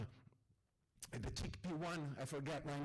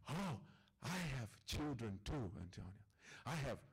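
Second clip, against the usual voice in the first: put-on Ahmed's accented English; 6 semitones lower than usual. Storyteller voicing his friend Ahmed at dinner, low accented English.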